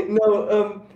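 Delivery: giggling through the words; laughing. Real, not acted. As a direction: laugh as you start talking.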